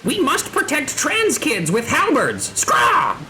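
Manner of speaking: high, squawky beastman voice; slow theatrical rallying cry with a squawk; rallying bird creature. Acted. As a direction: declare a cause as a bird-person.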